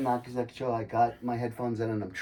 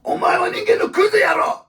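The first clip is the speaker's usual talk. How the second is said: yelled insult, much higher and louder; furious retold shout; 12 dB louder than usual. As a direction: scream it in his face. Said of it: "And I'm like".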